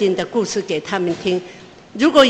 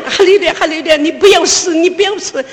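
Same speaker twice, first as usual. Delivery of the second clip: loud, high desperate calling out; panicked high-pitched shouts to a sick child; frantic shouting while running; 5 semitones higher, 4 dB louder than usual. Retold or imitated. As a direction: yell it out as you run for help. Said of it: "一边跑一边喊".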